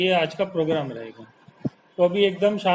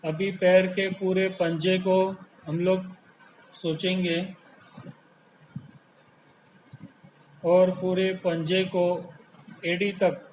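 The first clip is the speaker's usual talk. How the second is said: slow meditation voice with long pauses; hypnotic, stretched-out vowels on the calming words; guided relaxation; slower than usual. Real, not acted. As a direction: draw out each soothing word, lulling the body to let go. Leads a guided body relaxation.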